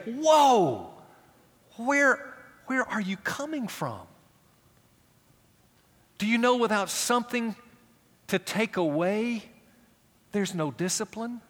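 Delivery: retold astonished challenge; incredulous retort. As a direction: push back in disbelief. His own retort to them.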